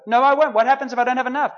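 high, loud protest; a loud, worried objection; anxious protest. Retold or imitated.

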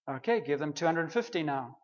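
soft, low, chuckling; a quiet laughing tease; laughing God voice. Retold or imitated.

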